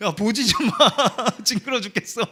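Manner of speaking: high, loud half-laughing scold. Retold or imitated. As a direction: shoo the kid away with a laugh. Telling child not to look. (웃으며 높고 크게 나무라듯)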